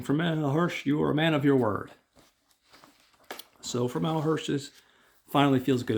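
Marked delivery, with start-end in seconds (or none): 0.0-1.9 put-on higher, louder praising old men; lightly voiced admiring elders. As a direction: praise him like grateful elders. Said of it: "they're saying".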